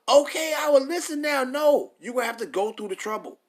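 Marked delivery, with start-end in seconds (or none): 0.1-1.4 higher, louder mock plea of a latecomer; hammed-up mock change of heart; mock repentance. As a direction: sarcastically play the sinner who gives in too late. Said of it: "So when the trouble come".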